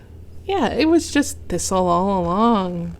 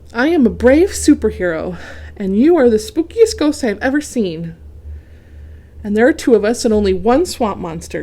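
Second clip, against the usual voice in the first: louder, eager pep talk; brave rallying; 3 semitones higher, 7 dB louder than usual. A narrator's reading. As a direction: rouse your friend to courage. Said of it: "Goose rallies Duck".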